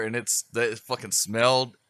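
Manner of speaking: normal talking voice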